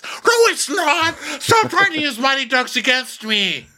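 high, whiny yelling; mock tantrum shout. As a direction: shout it like an outraged child. Acted tantrum in quarrel.

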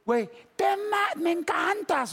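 very high, drawn-out adoration; gushing drunk fan. Retold or imitated. Fan about to leap onto the speaker, high slow gushing. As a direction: imitate a fan slowly squealing his love.

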